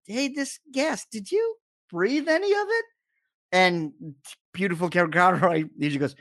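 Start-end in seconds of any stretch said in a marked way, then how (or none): 0.1-2.8 high youthful sidekick voice, much higher; Robin voice. Retold or imitated. Retelling the cartoon to a friend, high Robin voice. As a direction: play Robin asking Batman worriedly.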